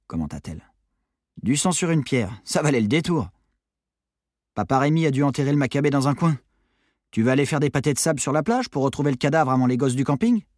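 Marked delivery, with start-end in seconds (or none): 1.4-10.4 put-on higher-pitched, sarcastic teen; mock his find, unimpressed; blasé teenage Maddy. (adolescente blasée et moqueuse)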